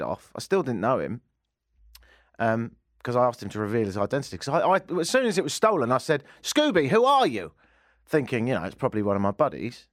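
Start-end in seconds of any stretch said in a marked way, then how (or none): 6.4-7.5 high, acted challenge to a thief; indignant calling out. Host confronting Scooby-Doo over stolen balloon, mock indignant call.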